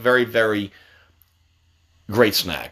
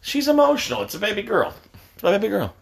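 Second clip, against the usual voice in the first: high, softened cooing; doting baby talk; 5 semitones higher, 4 dB quieter than usual. Acted. Parent defending favourite daughter, doting baby talk. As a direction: coo over your little one in a high voice.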